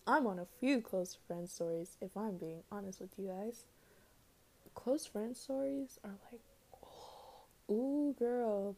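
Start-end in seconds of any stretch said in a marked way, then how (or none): 4.7-8.8 gossipy whisper. Real, not acted. Gossipy aside about close friends.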